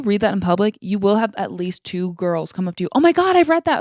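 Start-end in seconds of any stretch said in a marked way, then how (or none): 2.9-3.8 high, loud squealing gush over a book; excited fan gush. Retold or imitated. A stranger gushing to a reader in public, squealing excitedly.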